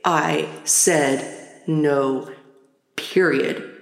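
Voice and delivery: low, measured "I said no, period"; imitating an enraged mother's final word; extra emphatic. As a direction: separate "no" and "period" with firm pauses. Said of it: "extra emphatically say".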